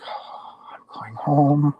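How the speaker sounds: barely voiced, marvelling at the light; awed whisper. Whispering to himself in the tunnel, awed and hushed. Inner cry approaching the light.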